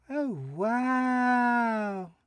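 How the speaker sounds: put-on high, drawn-out child wonder; a little kid amazed; awed child voice. A narrator's reading. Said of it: "A soft giggle".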